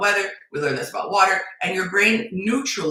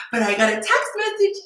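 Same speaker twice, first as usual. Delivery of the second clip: pitched way up, gushing over a text; mock excitement. Imitating a woman thrilled by one text, mock giddy. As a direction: sound giddy over nothing.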